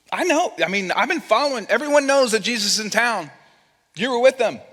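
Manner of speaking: acted-out accuser, voice swinging up high; accusing servant girl. Servant girl recognizing Peter at the fire, accusing.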